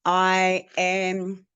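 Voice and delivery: normal talking voice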